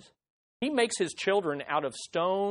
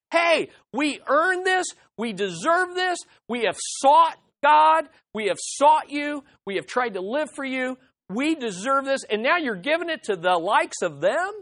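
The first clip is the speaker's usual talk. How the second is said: higher, indignant whine of the self-righteous; mock entitled complaint; 5 semitones higher than usual. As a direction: voice them as offended and owed, a bit hammed-up. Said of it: "What's driving this is".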